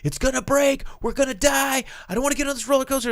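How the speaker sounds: high-pitched mock scream of terror; panicked screaming. Child protesting before the coaster, panicked screaming. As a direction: act out a child shrieking in panic.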